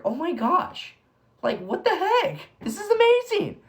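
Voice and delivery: high, loud amazement building to a peak; thrilled outburst. Real, not acted. Host reacting to a favorite Bible quote, thrilled outburst.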